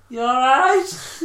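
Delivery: high, drawn-out timid question; sheepish. Retold or imitated. Friend checking on pinned Johnny, imitated sheepish voice. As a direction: ask it meekly, pitched right up. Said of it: "sheepish as out".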